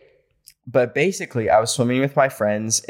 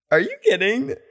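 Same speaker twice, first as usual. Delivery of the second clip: shocked disbelief; 11 semitones higher than usual. Real, not acted. Shocked reaction to creepy story.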